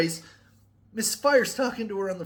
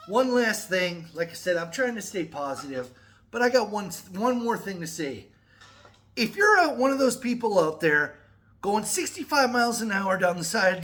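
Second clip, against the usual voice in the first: ordinary delivery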